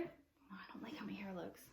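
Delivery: very soft whisper to herself; whispered aside. Real, not acted. Vlogger checking her hair between takes, whispered aside. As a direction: whisper it privately, under your breath.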